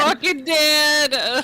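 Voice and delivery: high, slow, drawn-out shocked cry; stunned exclamation. Real, not acted. Friend stunned by the twist of a sad video, drawn out. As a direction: drag the words out in disbelief.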